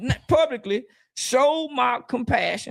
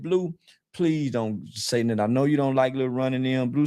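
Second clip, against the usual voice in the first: cousin pleading; 9 semitones lower than usual. Retold or imitated. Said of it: "my cousin Shell called me and said".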